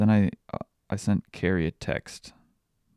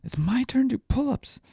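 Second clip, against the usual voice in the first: put-on much higher, piping little-girl voice; toddler voice; 4 dB louder than usual. Dad imitating daughter demanding her turn, high piping voice. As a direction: pipe it up like an insistent toddler. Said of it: "she just goes".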